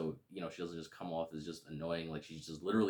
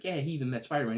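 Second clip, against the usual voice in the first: put-on high, louder eager girl voice; proudly blurting it out; voicing Liz; 7 semitones higher, 7 dB louder, faster than usual. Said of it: "She's like".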